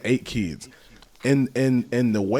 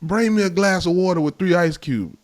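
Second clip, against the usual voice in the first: higher, demanding order; bossy grandfather; 9 semitones higher, 4 dB louder than usual. Retold or imitated. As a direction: order the kid around. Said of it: "And then you come right in front of".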